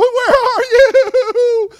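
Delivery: high, loud searching call. Retold or imitated. Preacher calling out for the lost sheep, loud and high.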